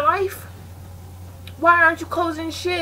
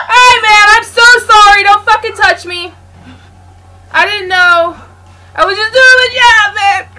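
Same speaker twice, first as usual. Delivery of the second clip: loud, higher, crying-out apology; panicked pleading; 6 semitones higher, 10 dB louder than usual. Real, not acted. Pleading with the killer who caught him, panicked shout. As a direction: beg for your life.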